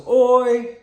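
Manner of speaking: OI high, level and loud; first tone. Shown as a demonstration. Demonstrating first tone for the tattoo, OI high and level. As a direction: hold OI out on one high note. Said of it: "first tone is two capitals".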